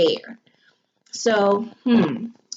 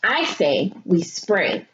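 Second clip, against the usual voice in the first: ordinary delivery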